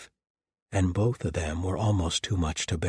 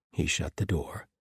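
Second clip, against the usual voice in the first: usual speaking voice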